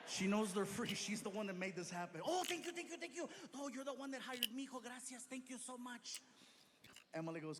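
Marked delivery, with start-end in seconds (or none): 2.2-6.2 high, gushing thanks; gushing mom. Retold or imitated. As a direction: overflow with gratitude.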